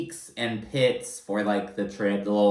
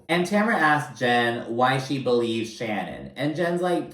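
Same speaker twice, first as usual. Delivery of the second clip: ordinary talk, nothing marked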